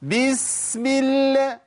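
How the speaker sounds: بسم with a long over-stretched hiss, high and very slow; mock the over-hissed S in بسم; a hammed-up whistling S; exaggerated hissing S. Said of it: "يزود براحته".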